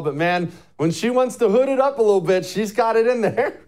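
ordinary talk

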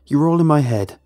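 loud, strained insistence; forceful, distraught protest; agitated denial. A narrator's reading. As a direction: shout the voices down. Narrator argues with voices in head.

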